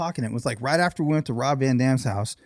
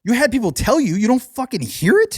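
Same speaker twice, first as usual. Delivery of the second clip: raised-pitch mock disbelief, hitting it hard; incredulous; 7 semitones higher, 5 dB louder than usual. Confronting a customer about her accent, mock incredulous. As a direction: play up exasperated disbelief, high and punchy.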